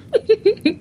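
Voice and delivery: squealing high laugh; a sudden shrill laugh breaking out; high burst of laughter. Real, not acted. Laughing after pirate line.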